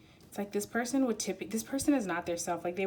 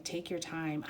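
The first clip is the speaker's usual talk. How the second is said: ordinary talk, nothing marked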